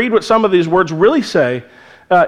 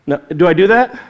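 normal talking voice

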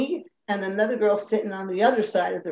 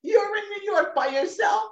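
high-pitched incredulous question; mock alarmed disbelief; 12 semitones higher than usual. Mother learns daughter is in New York, imitated alarm.

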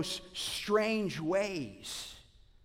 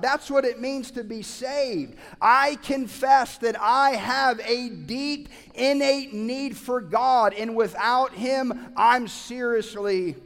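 loud, higher, slowed preacher's build; preaching at full force; 4 semitones higher, 7 dB louder than usual. Acted. Pastor urging the congregation to be hungry, full-force preaching. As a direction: drive each line home with fire.

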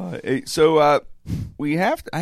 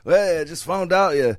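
lightly put-on British mob accent, pitched up; mock British gangster; 4 semitones higher than usual. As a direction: play a British mob heavy reporting back, half-joking. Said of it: "That's how they would say it".

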